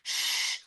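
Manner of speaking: sharp voiceless "shh"; retold shush; imitated shushing. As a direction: hush someone, imitating the doctor. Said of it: "doctor came out and told me".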